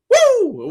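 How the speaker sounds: high loud whoop of delight; sudden high woo; excited woo. Real, not acted. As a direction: let out a happy cheer. Host cheers reading chat greeting.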